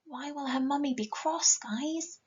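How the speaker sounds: ordinary delivery, nothing marked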